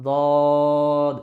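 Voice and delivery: "দদ" held long and loud, tongue front meeting the palate last; Dad closing at end. Shown as a demonstration. Teacher showing when the tongue meets, "দদ" long then closed. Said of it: "উপরে গিয়ে মিশবে এরকম".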